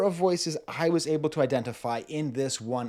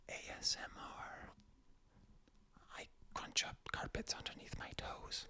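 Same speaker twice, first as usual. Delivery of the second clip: ASMR whisper; 19 dB quieter than usual. Shown as a demonstration. Demonstrates ASMR voice in list.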